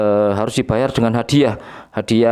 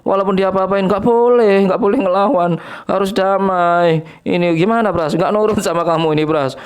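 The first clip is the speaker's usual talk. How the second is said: mock taunt; 7 semitones higher than usual. Taunting Pras in high voice.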